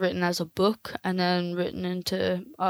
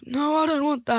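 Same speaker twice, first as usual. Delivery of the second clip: put-on higher old-man refusal; raised-pitch miser act; old man Scrooge; 7 semitones higher than usual. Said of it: "I'm an old man".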